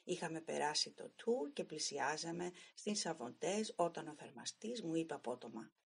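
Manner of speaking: ordinary delivery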